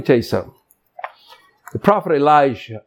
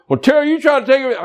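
fast, higher mocking complaint; mock objector; 11 semitones higher, much faster than usual. Imitating congregant complaining to Terry, fast mock whine. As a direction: rattle it off like a whiny critic.

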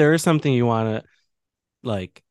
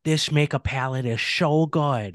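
slow put-on Boston makeup-girl accent; a light Boston accent, drawn out; imitating a Boston accent; 4 semitones higher, slower than usual. Imitating a TikTok makeup girl, slow Boston accent. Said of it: "The one who's like".

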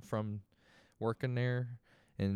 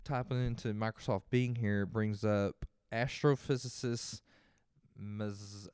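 ordinary delivery

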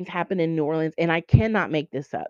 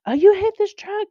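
imitating an alarmed girl, higher and louder; 8 dB louder than usual. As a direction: blurt it out in alarm. Girls alarmed after the crash.

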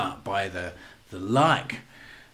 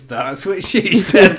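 higher, louder, slow deadpan; cheeky punchline; 6 semitones higher, 8 dB louder, much slower than usual. Retold or imitated. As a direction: land the joke slowly and cheekily. Punchline joke at till.

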